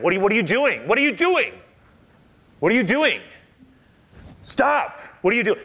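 high, loud startled cries; voicing the blind man's panic as a hand touches his eyes; alarmed protest. Blind man protesting the touch, alarmed and loud.

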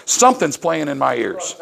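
ordinary delivery

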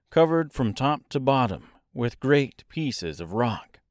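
ordinary delivery

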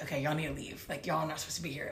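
low, soft official voice; retold order. Militia telling tourists to leave, understated order.

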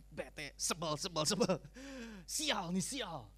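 mock fed-up grumbling; acted sulky annoyance. Preacher acts out a sulker whose business failed, fed up. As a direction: curse your luck in a huff. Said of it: "Suasana hatinya masih bete".

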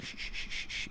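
sharp unvoiced shh over and over; aggressive shushing whisper. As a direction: shush him hard like a librarian. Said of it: "I'm an aggressive shusher".

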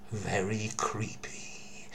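slow, eerie whisper; creepy whisper. Acted. Whispering slowly to listeners about the haunted theatre. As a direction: let it creep out slowly, barely voiced. Ghost tale told to listeners.

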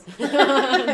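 loud, sudden real laugh; real outburst of laughter; burst of laughter. Real, not acted. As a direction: crack up out loud. Laughing at her own outrage.

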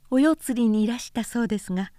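put-on light, quick, smiling young woman's voice; a lightly voiced woman teasing with a smile; smiling Miyo. A narrator's reading. Miyo teases the visitor with a smile, lightly voiced. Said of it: "と美代は微笑しながら言った".

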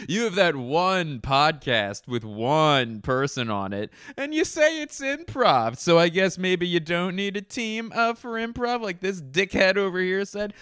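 high-pitched put-on voice of a heckler; ribbing character voice. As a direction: tease in a high comic character voice. Acts a caller ribbing Blake in skit.

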